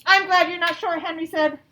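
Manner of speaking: slow, clear storybook reading; patient read-aloud to young pupils; reading to children. A narrator's reading. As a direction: read it out slowly for the class.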